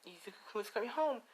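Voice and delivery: high, softly voiced girlish delight; happy. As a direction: savor the news in a high, happy voice. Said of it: "And, you know, she's happy".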